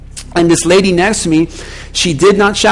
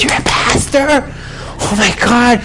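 overjoyed; 5 semitones higher than usual. Retold or imitated. Passenger learns he's a pastor, hammed-up joy. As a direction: imitate someone thrilled beyond belief. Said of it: "she got so happy that I was a pastor".

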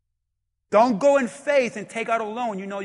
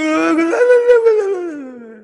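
long, loud, high blubbering wail; hammed-up widows' sobbing; mock wailing; 9 semitones higher, 12 dB louder than usual. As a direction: bawl wordlessly, exaggerated and drawn out. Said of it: "all the widows are like".

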